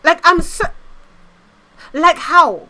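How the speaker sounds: high, loud disbelieving outburst; incredulous rant. Real, not acted. Streamer ranting to chat about Olympic events, incredulous.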